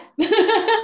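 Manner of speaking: high loud laugh; loud burst of laughter. Real, not acted. Laughing at the joke.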